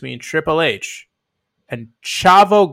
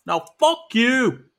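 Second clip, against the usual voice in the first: New York tough guy slipping into British, slow and high; hammed-up New York-British mashup heckle; botched New York accent; 8 semitones higher, 5 dB louder, slower than usual. Boston and New York fans trading insults, in a muddled New York-British accent. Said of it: "I just made New York British".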